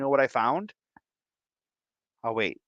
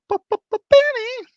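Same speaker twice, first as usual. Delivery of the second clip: stutter hype; 8 dB louder than usual. Acted. Stuttered name hype shout-out.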